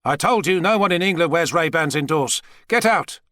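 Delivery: scold, then lift sharply on the dismissal; annoyed order. Acted. President ordering his two agents out, mildly angry.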